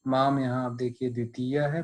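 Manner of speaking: usual speaking voice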